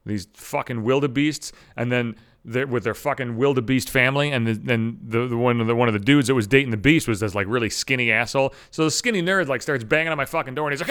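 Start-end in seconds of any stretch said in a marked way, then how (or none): none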